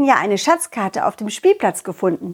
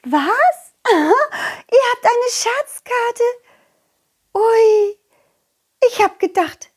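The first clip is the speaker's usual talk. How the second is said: put-on excited butterfly girl; 10 semitones higher than usual.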